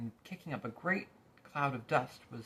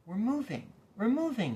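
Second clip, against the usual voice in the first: higher, louder, joyful cry; happy shout; 11 semitones higher, 8 dB louder than usual. A narrator's reading. Milo delighted the car moves, happy shout. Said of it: "he shouted happily".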